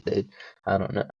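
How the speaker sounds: ordinary delivery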